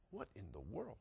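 low, very soft muttered thought; baffled aside. Retold or imitated. Puzzled private thought in story.

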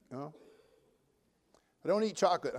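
normal talking voice

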